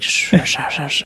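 hushed "shh" noises imitating whispers; imitated whispers. Guest shows hosts the whispers he heard, imitated hissing. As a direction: hiss out overlapping whisper sounds. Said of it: "I just hear whispers".

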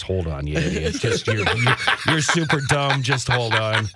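jaded screener. Retold or imitated. Producer parks a caller after losing rookie patience, low dismissive voice. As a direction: retell a fed-up producer brushing callers off. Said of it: "about a week later, I'm just".

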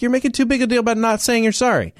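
high, fast retort; indignant comeback. Retold or imitated. Turning the seatmate's words back on him, indignant.

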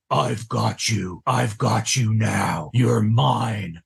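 low, slow menacing voice claiming him; a dark voice closing in, slow and low; menacing dream voice. Retold or imitated. Voice in the red truck taunting Eric, low and slow.

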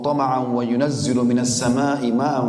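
slow melodic chant of Arabic verse; tilawah-style chanting; Quranic recitation. Acted. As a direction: draw out each phrase like tilawah.

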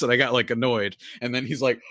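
normal talking voice

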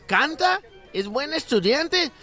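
lady chatting in the park; women trading gossip in the park. Acted. Joke: other lady, punchline.

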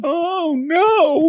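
put-on very high squeaky baby voice. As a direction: play the scared baby, tiny and high. Voicing baby startled by vacuum.

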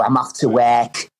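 slow Hull impression, "work" as "wek"; mock Hull accent. Teasing friend about Hull speech, mock Hull accent. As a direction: tease with a drawn-out Hull accent. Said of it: "saying work like they do".

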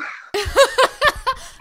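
a high, bright burst of laughter; self-mocking laugh. Real, not acted. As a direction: laugh at your own denial. Admitting she's living in denial.

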